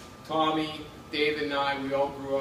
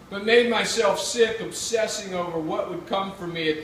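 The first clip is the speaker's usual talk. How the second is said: measured, louder reading-aloud voice; literary reading; 8 semitones higher, 9 dB louder than usual. A narrator's reading. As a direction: read it like a memoir on stage. Same words read from essay.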